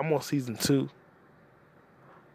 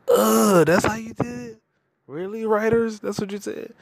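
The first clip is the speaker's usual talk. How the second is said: exasperated high complaint; 9 semitones higher, 4 dB louder than usual. Real, not acted. Griping at the Solo writers, high exasperated whine. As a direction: voice leaps high in disbelief. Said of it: "complaining to the writers".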